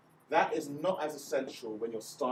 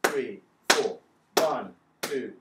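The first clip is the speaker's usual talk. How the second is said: loud counting with claps; counting claps aloud; 8 dB louder, much slower than usual. Shown as a demonstration. Teacher counting four claps aloud for the class. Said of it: "So four claps".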